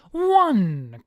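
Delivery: very loud, high drawn-out count; yell. A narrator's reading. Satan whips the second man, booming yell. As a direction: bellow the count. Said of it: "raises his arm and yells".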